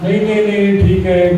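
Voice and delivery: high, hurried nervous denial; nervous brahmin backpedalling to his host; flustered denial. Acted. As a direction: cover it up fast, caught out.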